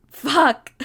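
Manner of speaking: sharp loud pained yell; yelled curse. A narrator's reading. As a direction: yell it out in sudden pain. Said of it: "you yelled".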